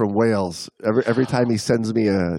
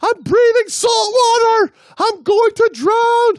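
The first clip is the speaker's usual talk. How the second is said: very high, slow, loud drowning shriek; panicked screaming; 5 dB louder, slower than usual. Acted. The diver's brain screaming that she will drown, shrieking panic. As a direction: scream each phrase out in hammed-up terror. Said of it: "The rest of my brain was screaming".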